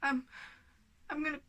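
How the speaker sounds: tense, strained talk from real worry about a sick pet; holding it together while the cat is at the vet; worried and shaken. Real, not acted. Telling viewers the cat is back at the vet, worried and strained.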